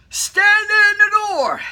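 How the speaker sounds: loud slow shouted order; slow, firm shout of command; jump master command. Retold or imitated. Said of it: "turns to me and says".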